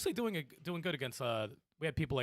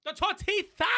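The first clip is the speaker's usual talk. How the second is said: high laughing shout; shouted intro; 8 dB louder than usual. Real, not acted. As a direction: yell the name out, laughing. Calling out a host name.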